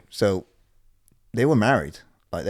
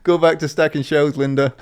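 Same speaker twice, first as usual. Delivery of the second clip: mock shop worker; 6 semitones higher, 7 dB louder than usual. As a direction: shoo a coworker back to work. Voicing store worker.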